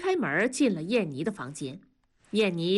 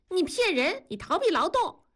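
put-on accusing little girl; 10 semitones higher than usual. Younger sister accuses older sister.

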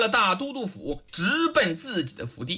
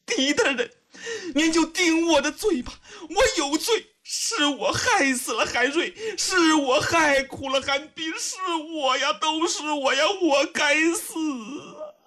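high-pitched acted sobbing confession; weeping young woman blaming herself; tearful outcry; 7 semitones higher than usual. A narrator's reading. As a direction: wail in self-blame, voice high and tearful. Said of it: "突然间声泪俱下".

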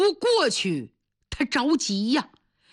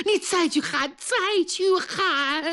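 desperate, tearful order; 9 semitones higher, slower than usual. Acted. Father orders daughter in panic.

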